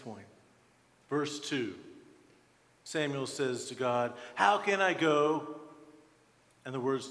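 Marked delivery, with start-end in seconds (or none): 4.3-5.6 raised, louder plea to God; a pointed, higher-pitched question; Samuel's protest. Acted. Samuel objects to God's order, protesting aloud.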